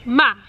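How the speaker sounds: ma falling sharply, final; fourth tone ma. Shown as a demonstration. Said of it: "just going down and very final".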